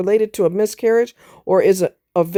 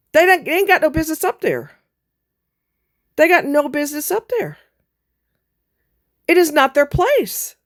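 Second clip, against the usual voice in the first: angry rant; 6 semitones higher than usual. Real, not acted. Angry rant about lawmakers.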